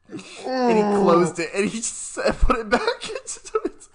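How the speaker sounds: cracking up telling the punchline; real laughter; laughing. Real, not acted.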